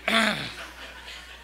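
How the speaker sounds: a short choked-up laugh; emotional laugh. Real, not acted. Moved after reading lyrics.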